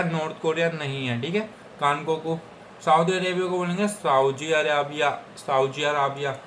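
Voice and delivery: usual speaking voice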